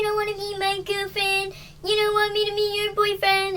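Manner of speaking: high, drawn-out whiny wail; mock crying. Boyfriend whines to his girlfriend as they argue, mock crying. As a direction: imitate a weepy, pleading boyfriend. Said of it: "So he's, like, crying and screaming".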